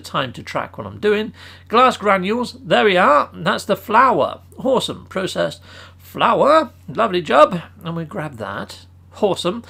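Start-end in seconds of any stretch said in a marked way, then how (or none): none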